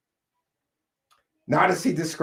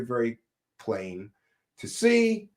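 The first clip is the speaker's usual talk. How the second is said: ordinary talk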